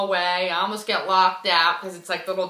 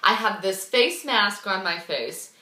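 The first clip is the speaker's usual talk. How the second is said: ordinary delivery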